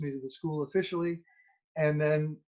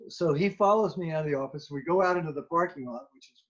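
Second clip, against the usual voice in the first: normal talking voice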